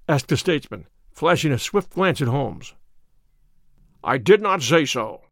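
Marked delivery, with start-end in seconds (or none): 4.0-5.2 imperturbable. A narrator's reading. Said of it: "said Holmes, imperturbably".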